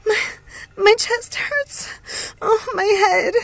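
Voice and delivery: high, slow, pained wail; agonised. A narrator's reading. Hope tells her sister where it hurts, in agony. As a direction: groan the words out in agony. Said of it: "panting in agony".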